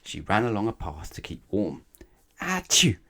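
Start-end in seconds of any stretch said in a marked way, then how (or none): 2.4-3.0 a big, high sneeze bursting out; loud sneeze. Acted. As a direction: sneeze loudly, fully voiced. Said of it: "Somebody sneezed".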